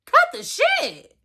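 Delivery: cartoonish disgusted scolding; over-the-top incredulous telling-off; mock outraged. Continued rant, exaggerated voice.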